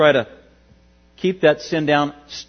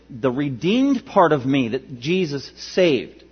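ordinary delivery

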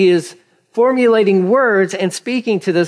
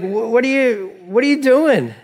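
protesting donkey; 7 semitones higher than usual. Acted. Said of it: "The donkey's like".

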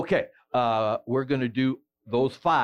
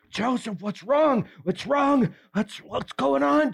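worried cries to a fallen man; panicked calling; 10 semitones higher than usual. Retold or imitated. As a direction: call out to a collapsed friend in alarm. Saul panics over fallen Joseph.

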